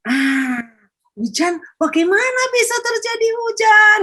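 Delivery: put-on curious child. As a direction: ask like a child seeing rain. Child wondering about rain.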